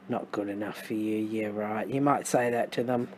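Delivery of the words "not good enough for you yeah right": softer, scoffing yeah right; mock the apology under your breath; sarcastic. Shown as a demonstration. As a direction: reader scoffing at the card message, sarcastic. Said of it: "I'm feeling this is sarcastic".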